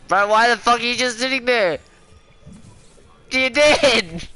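high, loud raging yell; furious shout. Real, not acted. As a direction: scream at the idle teammate. Raging at idle teammate.

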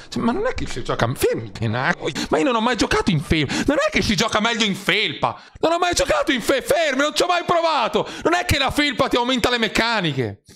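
high, agitated, rising rant; heated excited rant. Real, not acted. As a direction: get worked up and insist. Co-caster ranting, escalating.

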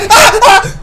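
high, loud short screams; terrified scream. Real, not acted. Player caught while fleeing, screaming. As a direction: shriek on being caught.

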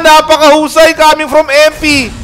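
very high, loud excited call; hype call. Real, not acted. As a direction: scream the big play to the crowd. Live play-by-play of a big play.

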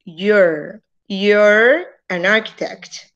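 You're an architect, slow, with the R of You're clearly heard; careful slow You're with a clear R; slow sounded R. Shown as a demonstration. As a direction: stretch You're and let the R ring. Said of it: "debe sonar la R".

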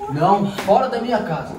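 loud, forceful command; angry eviction order. Acted. Man ordering his girlfriend out, angry command. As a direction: throw her out with your voice.

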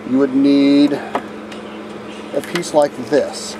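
ordinary talk, nothing marked

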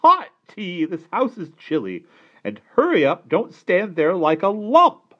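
loud, high, demanding aunt; bossy aunt. A narrator's reading. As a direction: order them about at full voice. Bossy aunt ordering tea.

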